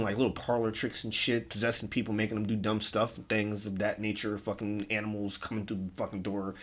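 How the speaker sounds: ordinary delivery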